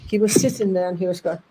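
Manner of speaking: ordinary delivery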